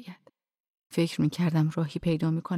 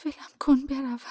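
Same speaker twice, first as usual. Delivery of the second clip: a touch of stammering fear; a hint of panicked stammer; stammering plea; 6 semitones higher than usual. A narrator's reading. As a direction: stutter it out, scared. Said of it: "تته پته کنان گفتم".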